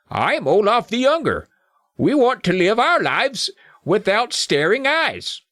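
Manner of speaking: high, slow gnome character voice; younger gnome. Acted. Young gnome confronts intruders, high gnome voice. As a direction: pitch it up and stretch the words like a gnome. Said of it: "mixed up the voices with the older and the younger".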